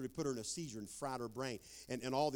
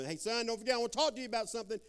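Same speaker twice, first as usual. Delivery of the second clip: calling after son; 10 semitones higher, 5 dB louder, much faster than usual. Retold or imitated.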